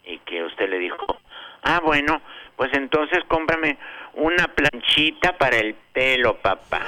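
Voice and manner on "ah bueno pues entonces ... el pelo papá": mock childish voice. Young Beto's joke request.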